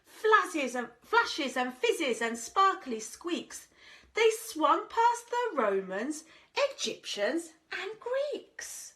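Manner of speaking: lilting rhymed storybook read; sing-song storyteller voice; reading to children. A narrator's reading. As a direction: read it like a bedtime picture book.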